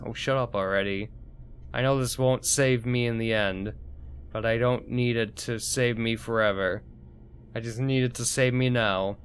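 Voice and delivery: usual speaking voice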